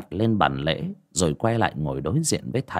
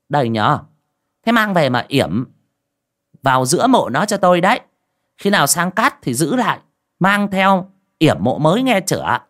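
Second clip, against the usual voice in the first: put-on higher, louder old sorceress giving orders; 8 semitones higher, 4 dB louder than usual. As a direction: hand it over with a bossy, higher voice. Sorceress gives the charm. (giọng bà thầy cao, to, ra lệnh)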